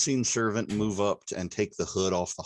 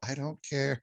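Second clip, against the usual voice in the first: high-pitched, put-on indifference; mock dismissive reply; 6 semitones higher than usual. Player jokes about ignoring the pleading goblin, high mock indifference. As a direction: toss it off high, as a joking in-character answer. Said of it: "if I just go".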